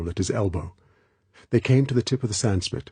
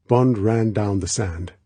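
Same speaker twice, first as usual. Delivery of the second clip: ordinary delivery, nothing marked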